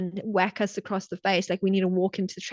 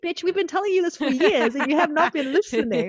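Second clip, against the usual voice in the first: much higher, sassy told-you-so voice; intuition scolding; 7 semitones higher than usual. Retold or imitated. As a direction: gloat like the universe finally proved right.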